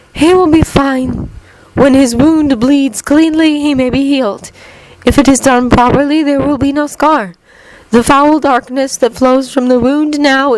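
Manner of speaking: high, measured otherworldly woman's voice; calm wise Keeper. A narrator's reading. Keeper telling worried wizards he will heal, high calm voice. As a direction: reassure them in a high, solemn lilt.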